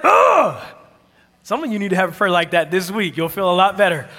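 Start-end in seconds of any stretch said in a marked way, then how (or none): 0.0-0.6 loud, high wordless groan of frustration; hammed-up exasperated "ugh". Out of words with God, frustrated groan. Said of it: "You ever have a prayer like that?".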